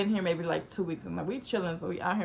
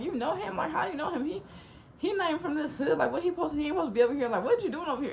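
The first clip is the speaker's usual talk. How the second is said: higher-pitched incredulous grilling; acted suspicious questioning; 8 semitones higher, faster than usual. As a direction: fire off doubtful questions, pitched up. Friends question the outsider's presence.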